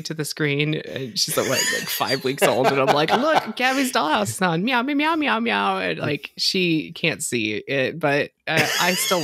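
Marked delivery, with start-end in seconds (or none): 3.1-5.8 put-on high, singsong parent coaxing a baby; playful high meowing parent voice; excited baby talk. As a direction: imitate showing a baby a cartoon, pitched up. Acts showing cartoon to five-week-old baby.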